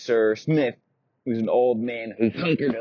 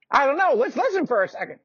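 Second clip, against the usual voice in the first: put-on very high, creaky old-man squeak; a doddery old knight, indignant; old knight insists he is still strong; 6 dB louder, faster than usual.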